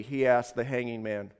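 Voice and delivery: ordinary delivery, nothing marked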